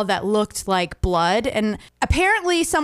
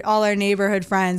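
ordinary talk, nothing marked

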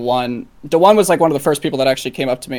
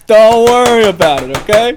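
playful cagey; 7 semitones higher, 7 dB louder than usual. Pretend. Teasing dodge about secret stash.

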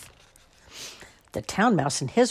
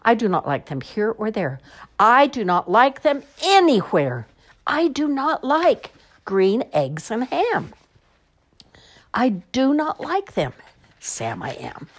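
building refusal; 8 semitones higher, 6 dB louder than usual. Acted. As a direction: climb to a fed-up crescendo.

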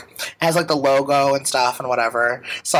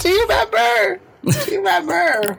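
excited. Real, not acted. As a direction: yelp it out gleefully. Excited shouted callback.

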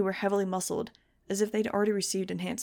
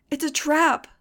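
high, loud cry of alarm; frantic bellow; 8 semitones higher, 7 dB louder, slower than usual. A narrator's reading. Narrator warning a crowd that won't listen, frantic bellow. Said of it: "I bellowed at them".

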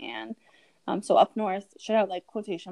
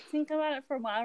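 ordinary delivery

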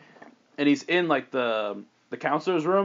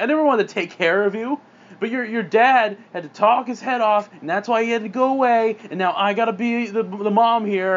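raised, heated rant; resentful mother; 7 semitones higher, 4 dB louder than usual. Acted. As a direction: blame the daughter bitterly. Mom blames daughter in fight.

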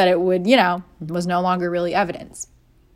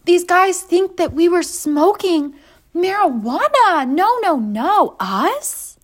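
much higher, slow, faux-innocent pleading; mock innocent girlfriend; 9 semitones higher, 4 dB louder, slower than usual. Girlfriend telling boyfriend the guards suspect marijuana, faux-innocent. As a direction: play innocent, overdoing it. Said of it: "I'm like".

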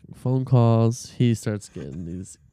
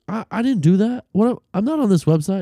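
imitating a roommate's panicked denial, much higher and louder; mock freak-out, pitched way up and loud; 11 semitones higher, 6 dB louder than usual. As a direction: squeal the denial like you're cornered and scared. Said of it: "would freak out".

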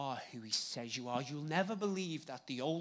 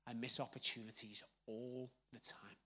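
whisper; 5 semitones lower, 10 dB quieter than usual. Shown as a demonstration. Preacher confessing to the congregation, whispering.

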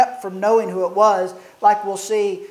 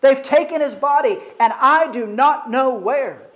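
higher, pleading with grief; a grieving woman's higher plea; distraught Mary; 5 semitones higher than usual. Acted. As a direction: voice Mary's heartbreak, pitched up. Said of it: "she says".

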